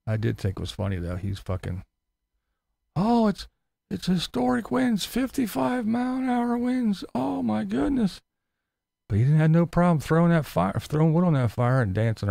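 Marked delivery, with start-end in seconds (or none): 2.9-8.2 high whiny mock; mocking whine. Vlogger mocking a YouTuber's historic winds claim, high and whiny. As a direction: drag out the drama.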